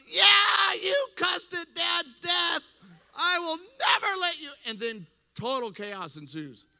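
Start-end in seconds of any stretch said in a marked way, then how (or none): 0.1-4.5 high, screaming gleeful gloat; hysterical joy. Retold or imitated. Brother gloating that pastor cussed, hysterical joyful shouting. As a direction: shout it in hammed-up, high-pitched glee. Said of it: "falls to the floor in hysterical laughter and joy".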